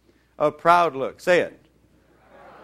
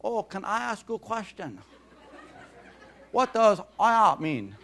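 higher, lightly voiced curious learner; puzzled English learner; 4 semitones higher than usual. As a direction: ask it innocently like a newcomer to English. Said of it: "And they say".

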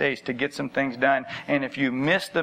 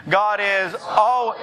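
"al-" held long on a raised pitch; slow, higher, leaning hard on "al-"; stress on ALWAYS; 5 semitones higher, much slower than usual. Shown as a demonstration. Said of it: "you gotta emphasize the always".

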